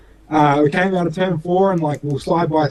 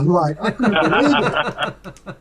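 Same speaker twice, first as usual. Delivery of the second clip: slow, laughing through the words; laughing disbelief; 4 semitones higher, much slower than usual. Real, not acted. Racer laughing about his own surprise, slow and amused. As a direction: chuckle while remembering. Laughing while recalling the pass.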